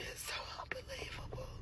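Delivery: crying in a broken whisper; tearful whisper. Real, not acted. Mother sobbing to camera, whispering in disbelief. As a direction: barely get it out through tears.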